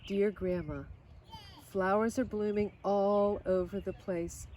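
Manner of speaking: ordinary delivery, nothing marked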